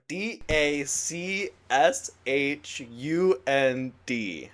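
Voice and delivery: letters spelled one by one, slow; spelling it out slowly. Shown as a demonstration. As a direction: spell each letter deliberately for Mark. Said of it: "Let me spell it for you".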